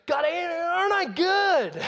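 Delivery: high, drawn-out shouted boast; boasting Pharisee. Acted. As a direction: crow it to God, loud and slow. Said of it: "And so he goes".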